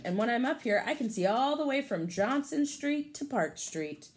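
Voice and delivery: lively storybook read for a class; picture-book read-aloud voice; reading to children. Acted. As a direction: read it brightly to the kids.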